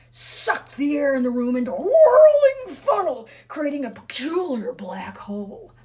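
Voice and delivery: swept up high and loud; dramatic climax. A narrator's reading. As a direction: let the whirl lift your voice right up.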